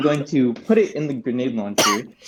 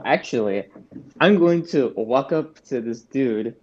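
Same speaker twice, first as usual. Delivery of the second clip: ordinary delivery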